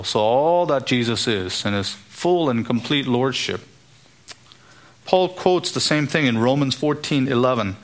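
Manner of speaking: ordinary talk, nothing marked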